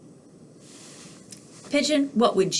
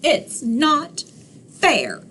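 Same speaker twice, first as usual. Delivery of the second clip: plain reading voice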